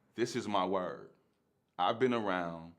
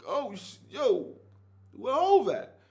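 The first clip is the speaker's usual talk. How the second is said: starstruck gasp; 6 dB louder, slower than usual. Retold or imitated. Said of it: "I was like".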